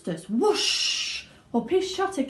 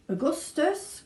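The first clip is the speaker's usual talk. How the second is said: raised call of alarm; Mr Wonka crying out; 8 semitones higher, slower than usual. A narrator's reading. Wonka calling the boy off his river, raised. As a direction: call the boy back sharply. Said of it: "cried Mister Wonka".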